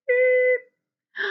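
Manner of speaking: high, loud beep sound; a sharp electronic beep; imitated beep. Shown as a demonstration. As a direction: make the thermometer's beep noise.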